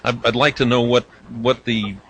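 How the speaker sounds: normal talking voice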